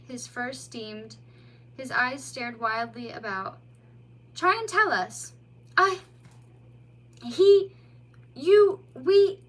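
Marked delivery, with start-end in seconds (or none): 4.3-5.3 higher, louder worried voice; concerned mole. A narrator's reading. Mole to the bleeding otter, concerned. 5.8-9.4 high, loud broken words; too shaken to speak; stammering young otter. A narrator's reading. Frightened otter trying to tell the mole, stammering.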